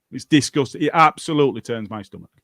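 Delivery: ordinary talk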